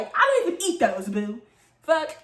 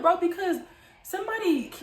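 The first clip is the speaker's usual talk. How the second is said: ordinary talk, nothing marked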